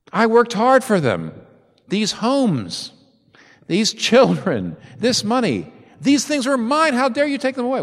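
a proud man's mock outrage at losing his things; indignant claim. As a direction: build to an affronted demand. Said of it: "He does not say".

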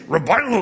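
"read your Bible" blurred until it becomes "revival"; imitating Doctor Ebling. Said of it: "He would say".

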